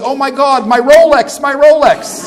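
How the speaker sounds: high, loud mock wail over the Rolex; hammed-up panic. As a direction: shriek it like a comic panicking victim. Punchline, arm torn off.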